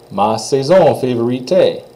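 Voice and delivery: Ma saison favorite, T run into the final sound; teacher demo; French pronunciation. Shown as a demonstration. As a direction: model favorite with te as one sound. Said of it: "that T gets kind of run into that A sound".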